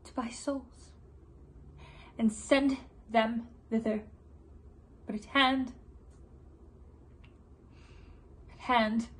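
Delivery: halting, portentous stage delivery; Queen Margaret. Acted. Vengeful queen foretelling his end, measured and halting. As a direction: let each phrase hang in a long pause. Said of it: "a portion of Queen Margaret's monologue".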